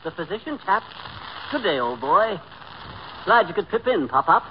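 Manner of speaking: chummy English gent greeting; put-on jolly British accent; mock British accent. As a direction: greet him as a British toff. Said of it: "this Bond Street brogue".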